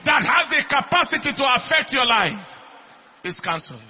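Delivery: high, loud declaration, pushed hard; shouted pulpit proclamation; full-force preaching. Real, not acted. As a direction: preach it as a forceful decree over the congregation.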